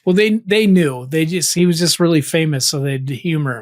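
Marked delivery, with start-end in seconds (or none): none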